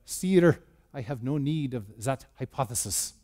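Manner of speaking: put-on a light French accent, puffed up and soft; a hint of French, haughty and dropping quiet. A scientist answering the emperor with a light French accent. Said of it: "That's my French accent".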